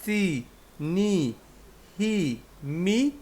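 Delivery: slow teaching drawl, চি নি হি মি; drawn-out syllables. Shown as a demonstration. Teacher stretching kana sounds for learners, slow and soft. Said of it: "সবকটা word".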